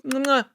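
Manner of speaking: a smacking air kiss; kiss sound. Pretend. Showing the boyfriend an easy good morning kiss sound. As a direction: blow a quick kiss.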